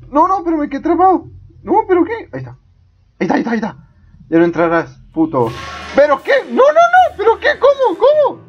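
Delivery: high disbelieving shouts; shocked yelling. Real, not acted. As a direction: yell in stunned disbelief. Caught again, shocked yelling.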